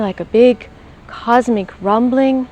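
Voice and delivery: usual speaking voice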